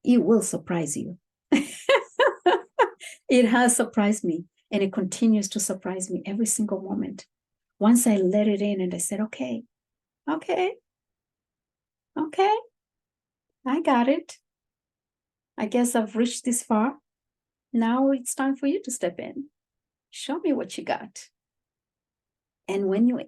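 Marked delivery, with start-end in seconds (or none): 1.5-3.2 high, loud burst of laughter; bubbling, high-pitched laugh; amused laugh. Real, not acted. Amused at being surprised herself. 9.2-21.3 high-pitched reenactment of giving in; raised, rising voice for a retold self-talk; acted-out surrender. Acts out yielding to higher self.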